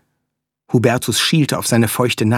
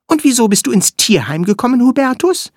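high, quick little flea voice; squeaky tiny creature; 12 semitones higher, 3 dB louder than usual. Acted. Flea character speaks.

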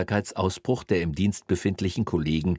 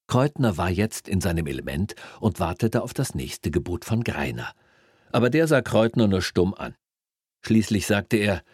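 usual speaking voice

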